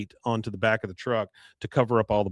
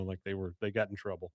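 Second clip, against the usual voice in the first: ordinary talk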